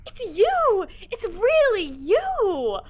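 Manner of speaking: bursting with delighted disbelief; can't believe he's really here; overjoyed. Acted. Girl recognizes Santa, overjoyed.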